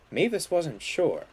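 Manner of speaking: ordinary delivery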